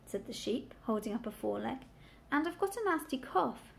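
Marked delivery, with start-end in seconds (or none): none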